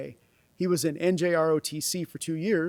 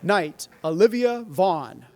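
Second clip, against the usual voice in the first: slow, loud formal name call; knighting ceremony; 5 dB louder, slower than usual. Real, not acted.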